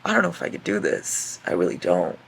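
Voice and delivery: low, creaky despairing voice; going through it. Real, not acted. Reader unsure she can keep going, overwhelmed low croak. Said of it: "I'm going through it".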